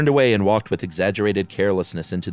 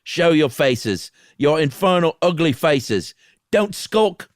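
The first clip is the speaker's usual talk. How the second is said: high, loud taunting yell, drawn out; defiant shout; 8 semitones higher, 5 dB louder than usual. Acted. Meagle taunting the unseen ghosts, loud and high. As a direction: shout at the ghosts to show themselves. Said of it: "he cried with a low laugh".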